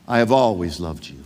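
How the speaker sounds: tender voice of God. Acted. God speaking love softly over his listener. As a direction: let it settle low and gentle, like a promise. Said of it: "I want you to hear God speaking this over you".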